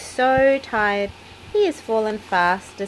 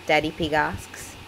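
usual speaking voice

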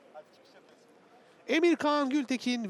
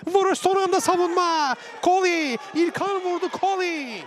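excited commentator; 7 semitones higher, 4 dB louder than usual. Real, not acted. Chance saved, commentator excited.

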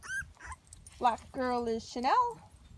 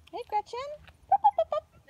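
much higher and louder pup-pup chant; puppy calling voice; 13 semitones higher, 7 dB louder, much faster than usual. Real, not acted. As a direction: chirp to call the puppy.

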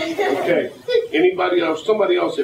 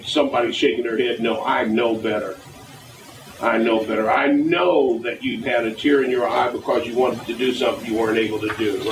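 normal talking voice

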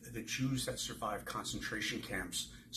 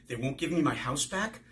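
a touch of alarm, pitched higher and louder; frightened; 5 semitones higher, 6 dB louder than usual. Acted. Young Eva hearing she may lose her home, mildly frightened. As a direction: ask it with sudden fear rising. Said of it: "Eva gasped as a bolt of fear".

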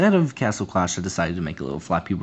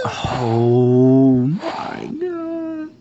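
long loud frustrated oh my god; drawn-out exasperated groan; 5 semitones higher, 4 dB louder, much slower than usual. Real, not acted. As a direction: stretch it out loud in despair. Gamer dies again, drawn out.

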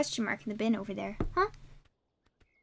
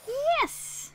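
excited cheer. Real, not acted. Success in game.

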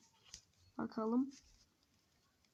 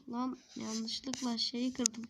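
ordinary talk

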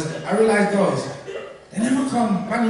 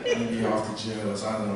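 low mutter; 11 semitones lower, 10 dB quieter than usual. Retold or imitated. Sweating, nervous low aside.

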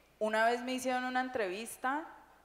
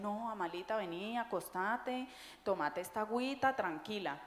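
gentle comforting; 6 dB quieter than usual. Retold or imitated. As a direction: coax her to lie down and rest. Friends comforting a sick Amalia.

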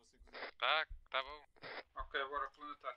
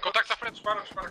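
shouting; 8 semitones higher, 10 dB louder than usual. Real, not acted. Urgent callout under fire.

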